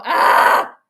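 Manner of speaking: sharp unvoiced yell of tension; sudden shout. Real, not acted. Fan watching the last play, a sudden tense shout. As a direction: blurt out a shout as the play goes off.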